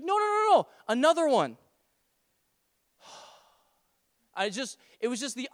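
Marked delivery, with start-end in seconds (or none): 0.0-1.6 put-on high-pitched, rapid little-boy protest; insistent child. Son correcting dad at the shop, insistent child. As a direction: imitate a small kid insisting. Three-year-old correcting his father.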